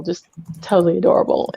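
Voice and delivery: ordinary delivery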